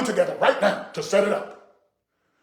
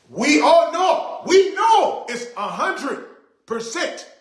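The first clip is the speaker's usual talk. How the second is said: exasperated shout; 7 semitones higher, slower than usual. Real, not acted. Ranting about fifty fifty.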